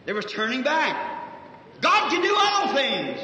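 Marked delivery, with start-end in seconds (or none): 1.8-3.2 slow, louder and higher declaration; preaching proclamation. Real, not acted. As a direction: proclaim it slowly to the congregation. Preacher proclaims at full force.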